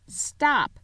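sharp high stop; witch's command. A narrator's reading. Witch halting her followers mid-drag. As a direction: halt them with one word. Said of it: "said the witch".

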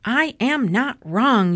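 insist, lightly shouted; shouting. A narrator's reading. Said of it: "Eugenia shouted".

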